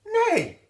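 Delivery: very high, loud whinny; mighty neigh. A narrator's reading. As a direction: neigh with all her might. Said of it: "with all her might".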